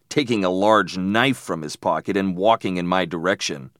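ordinary delivery

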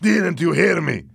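loud, high challenge; angry demand. A narrator's reading. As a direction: demand it, outraged at being ignored. Louis angry at being ignored.

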